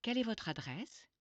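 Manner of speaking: teacher demo in French accent. Shown as a demonstration. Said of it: "Try to say it with a French accent".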